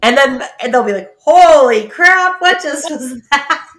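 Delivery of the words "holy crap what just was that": shocked exclamation. Retold or imitated. Friends react to her accent.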